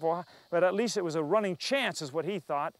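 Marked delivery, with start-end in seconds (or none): none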